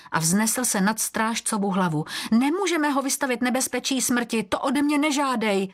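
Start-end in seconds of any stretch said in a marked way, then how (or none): 2.3-5.7 high-pitched pleading refusal; desperate protest. A narrator's reading. Eagle refuses to put the boy in danger, high desperate protest.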